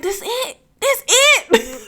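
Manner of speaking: high-pitched incredulous asking, giggling by the end; underwhelmed disbelief. Retold or imitated. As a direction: squeak it out in mock letdown, then laugh. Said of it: "it was just like".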